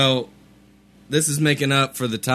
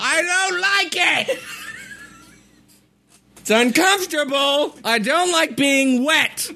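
whiny. Acted. Whiny complaint about sweating.

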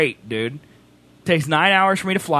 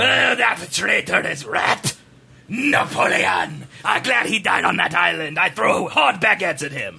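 lightly guttural French, slow; hammed-up Frenchman; mock French accent. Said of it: "what this accent is".

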